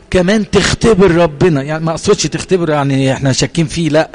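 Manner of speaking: ordinary talk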